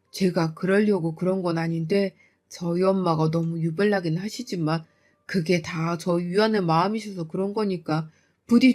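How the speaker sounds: grovel and beg forgiveness; meek husband. Acted. Husband apologizing meekly.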